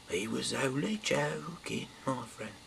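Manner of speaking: a hint of a mocking friend; mocking dismissal. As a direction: brush off the warning with a sneer.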